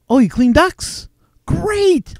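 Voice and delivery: high-pitched mock enthusiasm; feigned delight. Prankster replying to a duct-cleaning telemarketer, feigned delight. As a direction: act thrilled by the offer, pitch jumping up.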